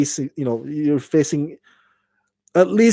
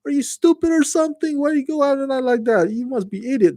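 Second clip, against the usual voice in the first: much higher, fast, put-on scolding voice; mock yelling; 12 semitones higher, faster than usual. Bad leader berating survivors, high mock yelling. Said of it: "or start yelling at them".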